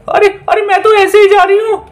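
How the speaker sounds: very high, hurried witch voice; a witch scrambling off; fleeing witch. Acted. Witch flees the injection.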